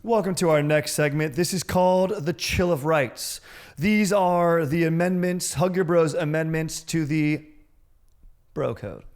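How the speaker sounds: high, loud, stretched-out announcer voice; segment announcer. Pretend. Host introducing the next segment to listeners, announcer voice. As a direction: proclaim it slowly and grandly.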